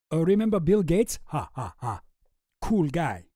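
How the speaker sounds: mock-Russian chumminess with a staged laugh; Boris with Russian accent. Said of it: "trying to practice the voice".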